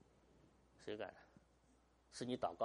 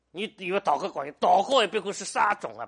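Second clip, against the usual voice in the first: loud challenge; 6 semitones higher, 12 dB louder than usual. Real, not acted. Pastor challenging reliance on prayer.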